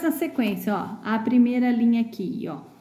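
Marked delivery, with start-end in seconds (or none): none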